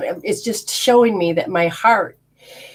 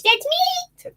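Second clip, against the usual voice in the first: very high spirit voice on that's me; higher voice. Retold or imitated. Spirit mother trying a higher voice, very high and light. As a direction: float it up as a ghostly high voice. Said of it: "if I use a higher voice".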